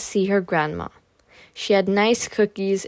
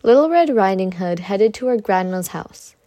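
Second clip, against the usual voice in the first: usual speaking voice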